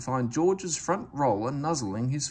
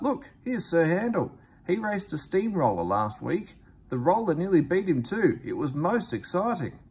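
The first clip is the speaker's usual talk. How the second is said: calling out excited; 6 semitones higher, 3 dB louder than usual. A narrator's reading. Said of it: "one called out".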